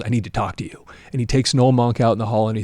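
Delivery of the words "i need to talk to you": low, quiet confidential murmur; hushed aside. Retold or imitated. Promoter quietly asks the tour manager for a private word, hushed. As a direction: pull him aside and speak under your breath.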